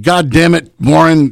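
high, exasperated outburst; exasperated curse. Retold or imitated. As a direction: curse at the prankster, pitched high. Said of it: "Bill Behrens is like".

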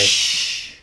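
long hissed shush. Real, not acted. Podcaster cuts off co-host's story, shushing. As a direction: hush the co-host with a drawn-out shh. Hushing co-host about what happened.

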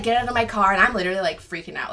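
ordinary talk, nothing marked

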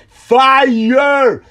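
high, drawn-out imitated yell; loud put-on shout, far above normal pitch. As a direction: holler it like an excited moviegoer. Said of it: "the guy to just yell out".

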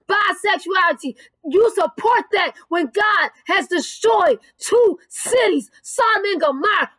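emphatic, punched-out preacher's rebuke; fire-and-brimstone preaching. Real, not acted. Preacher rebuking those who support sin, forceful preaching. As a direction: hammer each phrase like an indignant preacher.

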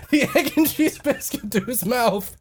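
usual speaking voice

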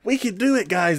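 high, chirpy mock pep talk; plucky main character. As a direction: imitate an ever-hopeful anime hero. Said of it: "the main character who's been like".

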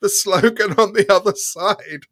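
can barely finish for laughing; helpless giggling. Real, not acted. Laughing through placard story.

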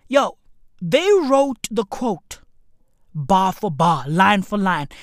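normal talking voice

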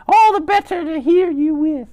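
high, loud falsetto granny; wolf posing as grandmother. Acted. Disguised wolf answering the grandson about big ears, in falsetto. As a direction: pipe it up in a fake granny voice. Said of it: "like that".

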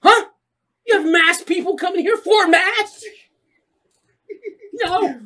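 high, loud mock sermon; preacher. Priest preaching to the congregation, high mock sermon. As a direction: preach at the crowd with hammed-up fervor.

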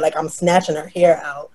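usual speaking voice

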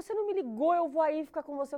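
high, loud imitation of a pushy mother; insistent mother. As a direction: push it like a mother inviting herself over.

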